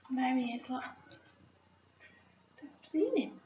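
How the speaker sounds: soft, excited murmur; hushed aside. Real, not acted. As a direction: point it out quietly. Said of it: "hushed aside to children".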